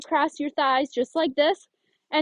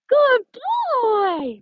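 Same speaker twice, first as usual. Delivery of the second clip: squeaky bright praise for a good dog; high-pitched praise; 9 semitones higher, 6 dB louder than usual. Shown as a demonstration. Trainer praising her dog in a high pitch. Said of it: "I give him praise at that high pitch".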